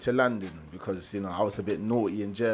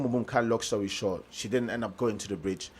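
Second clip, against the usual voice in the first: usual speaking voice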